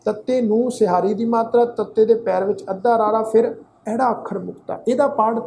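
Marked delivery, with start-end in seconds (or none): none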